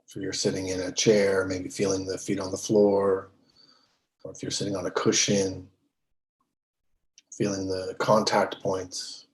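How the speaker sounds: measured, settled meditation-teacher voice, leaving space between phrases; guided meditation. Real, not acted. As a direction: guide them unhurriedly into stillness. Leads a meditation.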